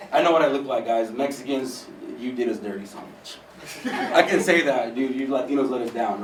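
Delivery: ordinary talk, nothing marked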